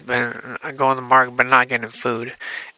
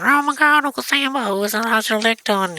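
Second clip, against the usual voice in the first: very high, gushing concern; imitating fussing relatives, high-pitched; mock fussing; 10 semitones higher than usual. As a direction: fuss over him in a high put-on voice. Relatives rush over in alarm.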